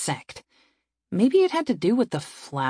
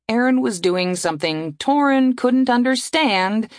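ordinary delivery, nothing marked

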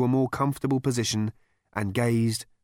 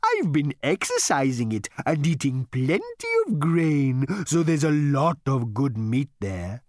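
high, slow, courteous cow; polite cow voice; 4 semitones higher, 4 dB louder than usual. A narrator's reading. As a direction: offer yourself like a cow waiter. The animal speaks.

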